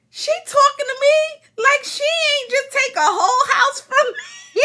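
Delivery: high-pitched, laughing disbelief; incredulous. Retold or imitated. Retelling a reaction to her phone call, high incredulous voice.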